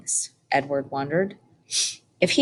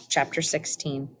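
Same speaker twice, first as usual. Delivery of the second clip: plain reading voice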